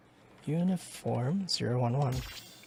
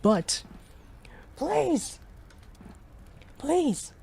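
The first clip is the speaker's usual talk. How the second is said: desperate plea; 14 semitones higher, much slower than usual. Acted. Begging alone in the fire.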